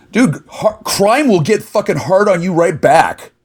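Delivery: angry retort. Real, not acted.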